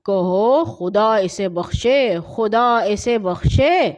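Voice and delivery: slow, hushed solemn blessing; slow solemn funeral blessing. Acted. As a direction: say it slow like a prayer for the dead. Mourner teaches a prayer.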